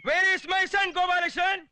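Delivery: high, loud officer's demand; commanding demand. Acted. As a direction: call out like a captain. Captain demands his son.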